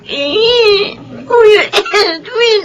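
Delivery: drawn-out whining call of his name; whiny plea. Acted. Sister whining at her older brother, tearfully. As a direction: whine like a spoiled little sister. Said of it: "Huwag ka nang umarte".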